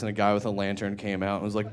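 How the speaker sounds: normal talking voice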